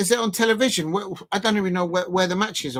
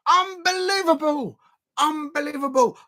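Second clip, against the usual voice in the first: incredulous protest; 6 semitones higher than usual. Real, not acted. Fan cannot believe penalty wasn't given, incredulous. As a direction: stretch the word out, aghast.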